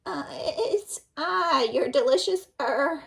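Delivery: wolf squeaking high, faltering; wolf faking a girl's voice at the door; high fake voice. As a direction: pretend to be a little girl, badly. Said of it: "said the wolf in a high voice".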